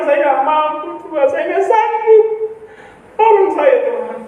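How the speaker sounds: re-enacted desperate prayer, pitched high; high, overwhelmed pleading, acted from memory; desperate plea. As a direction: beg God for help, voice climbing high. Retells own desperate plea to God.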